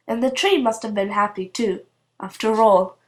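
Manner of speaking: usual speaking voice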